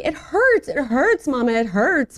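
high, insistent mock whine; crying little daughter. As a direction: imitate a child pleading with mom. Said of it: "she keeps telling me".

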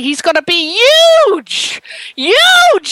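Jersey "huge", H dropped, stretched and punched; playful Jersey-style boast; Jersey accent. Acted. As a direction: put on a Jersey accent, booming the last word. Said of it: "as they say in Jersey".

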